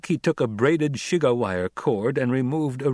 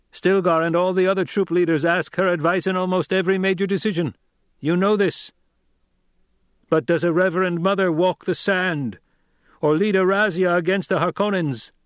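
higher, louder, commanding orator; young duke addressing tribe; 8 semitones higher, 5 dB louder than usual. A narrator's reading. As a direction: challenge the crowd with rising authority. Paul addresses the throng.

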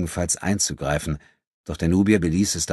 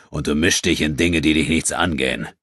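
growl. A narrator's reading. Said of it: "knurrte er".

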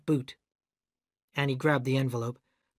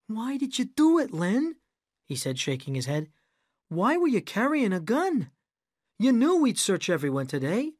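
much higher reproachful questions; reproachful director; 8 semitones higher than usual. A narrator's reading. Rick reproaching the narrator. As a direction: scold him, shaking your head.

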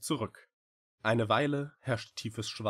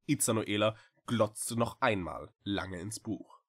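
plain reading voice

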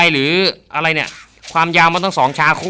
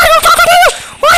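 very high screaming shout; triumphant shout; 8 dB louder than usual. Real, not acted. Gamer screaming in triumph after headshots.

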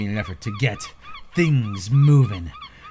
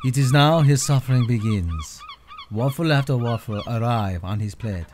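hushed nature documentary. Acted. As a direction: narrate like a wildlife film. Narrator mocks his challenge.

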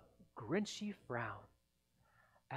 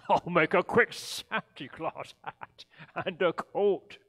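louder, gleeful Grinch; gleeful scheming; 2 semitones higher, 9 dB louder than usual. Acted. The Grinch plotting his Santa disguise, gleeful. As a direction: relish the plan.

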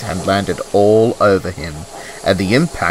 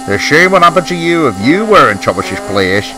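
mildly scolding, higher slurred boss voice; cross; 6 semitones higher than usual. A narrator's reading. Fat Controller scolds the laughing engines, mildly cross. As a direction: tell them off with a touch of crossness, pitched up. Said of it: "he said crossly".

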